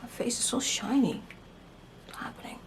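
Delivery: soft unvoiced aside; 혼잣말로 속삭이며; whispered aside. Real, not acted. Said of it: "aside about her face".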